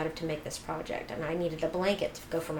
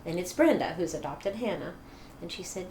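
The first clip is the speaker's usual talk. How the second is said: usual speaking voice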